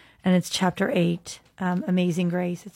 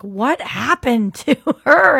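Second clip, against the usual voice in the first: drawn-out, high, loud astonished question; imitating amazed onlookers, pitched up and stretched; mock amazement; 6 semitones higher, 7 dB louder, slower than usual. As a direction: exclaim it slowly in hammed-up disbelief. Acts out amazed chaplains' question.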